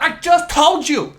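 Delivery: put-on high, loud snapping Vietnamese-accented lady; aggressive owner. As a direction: snap back impatiently in her accent. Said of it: "you're so aggressive".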